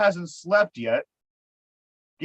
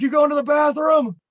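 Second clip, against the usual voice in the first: called out high and loud, over his earbud music; loud eager question; 10 semitones higher, 8 dB louder than usual. Retold or imitated. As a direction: blurt it out like a wired roommate. Wired friend calls out question.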